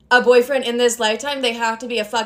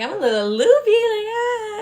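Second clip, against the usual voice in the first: acting loopy; 10 semitones higher than usual. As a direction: imitate being tipsy, voice floating way up. Acting out the kava kicking in.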